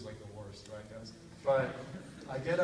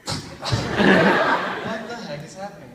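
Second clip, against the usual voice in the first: louder, slow disbelief broken by laughter; incredulous laughing; 5 semitones higher, 12 dB louder, much slower than usual. Retold or imitated. Retelling finding a stranger in his bed, laughing disbelief.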